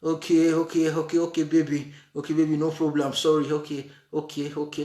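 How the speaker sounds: calm her down with gentle agreement; agreeable husband. Retold or imitated. Husband agreeing calmly to settle the quarrel, low and gentle. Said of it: "The man will say".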